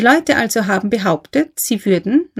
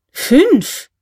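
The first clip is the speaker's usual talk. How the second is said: high-pitched burst of astonishment; astonished exclamation; 8 semitones higher, 4 dB louder than usual. A narrator's reading. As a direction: call it out, startled by the number. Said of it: "Erst die Zahl setzte ihn in Erstaunen".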